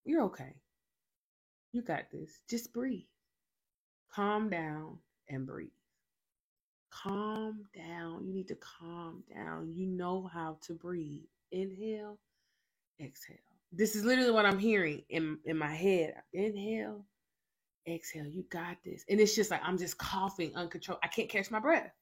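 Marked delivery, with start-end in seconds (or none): 0.0-13.4 soft, slow calming voice; comforting. Retold or imitated. Holy Spirit calming her in a coughing fit, softly comforting. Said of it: "he was comforting me". 16.3-19.0 comforting. Retold or imitated. Holy Spirit calming her in a coughing fit, softly comforting. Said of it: "he was comforting me".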